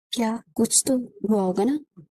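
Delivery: slow, defeated question; a broken groom on his knees, slow and defeated. A narrator's reading. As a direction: ask it as if crushed, slowly. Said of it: "اس کی آواز میں شکستگی نظر آ رہی تھی".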